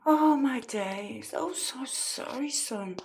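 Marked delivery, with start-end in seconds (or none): none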